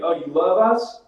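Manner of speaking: loud, high rising question; mock surprise. New Zealander friend twisting talk of kiwi fruit, mock surprised. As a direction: play up the teasing misunderstanding, voice up. Acted teasing kiwi misunderstanding.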